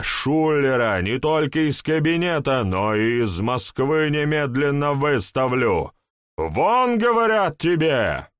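furious shout, getting away. Acted. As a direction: roar at him in fury. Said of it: "крикнул".

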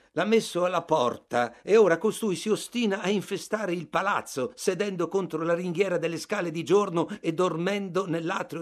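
voce alta e concitata, più forte; un portiere in ansia che incalza; agitato. Acted. Portiere agitato chiede di intervenire. (portiere agitato che supplica il narratore)

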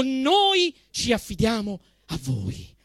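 ordinary talk, nothing marked

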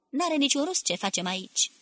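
lightly voiced brusque old man; brusque, hurried voice. A narrator's reading. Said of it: "cu glasul său repezit".

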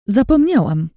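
high, loud sudden exclamation; sudden cry. A narrator's reading. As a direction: blurt it out as it hits you. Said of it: "krzyknęłam nagle".

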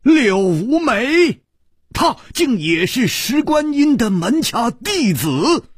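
high, loud cry of disbelief; astonished. A narrator's reading. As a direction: exclaim it in shock, pitch shooting up. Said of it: "都不禁大吃了一惊". (大吃一惊，高声惊呼)

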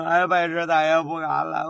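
hammed-up howl of a grovelling official; terrified wailing. A narrator's reading. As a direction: wail it out, stretching the final cry. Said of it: "这些人吓得哭嚎起来".

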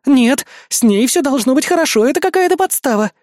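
high, urgent objection; indignant protest. A narrator's reading. Мария objecting to the rescue, indignant. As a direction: argue back in a high voice. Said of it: "возмутилась Мария Артисан".

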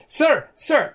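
high, loud calling out to the boss; urgent call. Acted. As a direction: call for his attention, urgently.

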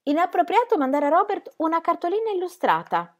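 high, stiff disapproval; indignant objection. Acted. Ingram scolding Ingeborg about the postcard, indignant. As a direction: rule it out with prim indignation.

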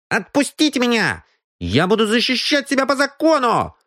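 put-on high, defiant street boy; a scruffy brat squaring up. Captured boy stands up to the detective, defiant.

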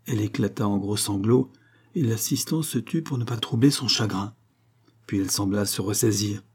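ordinary delivery

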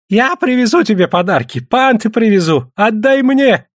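high, piping plea; squeaky voice. A narrator's reading. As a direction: squeal eagerly as a bidding suitor. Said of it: "пропищал Песотька".

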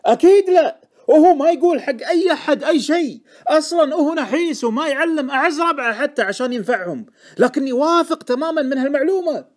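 very high, loud, agitated outburst; heated insistence. A narrator's reading. Informant defending his secondhand tip to Deacon, heated. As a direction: deny it loudly, then defend the tip.

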